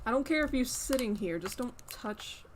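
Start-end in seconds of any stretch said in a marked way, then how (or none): 0.0-1.4 higher, louder hey hey; scolding. Real, not acted. Streamer warning her cat back off the laptop, loud. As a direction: call the cat off firmly.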